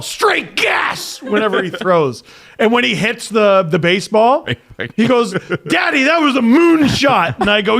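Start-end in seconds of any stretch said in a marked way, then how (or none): 0.0-1.2 mock yell. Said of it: "I taught him to yell". 5.7-7.4 put-on high, loud kid's boast to Dad; excited little son. Acts out his excited young son.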